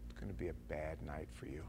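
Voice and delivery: very low, very soft menace; hushed low warning; cop's close-up threat. Acted. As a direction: threaten him quietly, right in his face. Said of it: "he gets right in my face".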